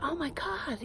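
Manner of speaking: high, soft, badly done American accent; self-mocking American accent impression; terrible American accent. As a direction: gush it in a clumsy American accent. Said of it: "I've got a terrible American accent".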